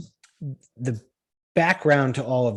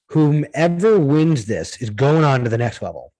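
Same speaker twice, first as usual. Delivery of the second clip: louder, raised announcement to competitors; lightly acted announcer; 3 semitones higher, 5 dB louder than usual. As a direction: lightly imitate an organizer addressing the whole group.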